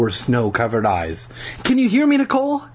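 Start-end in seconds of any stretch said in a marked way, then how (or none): 1.6-2.6 very high, panicked calling; mounting panic. A narrator's reading. Acted panic over frozen sister.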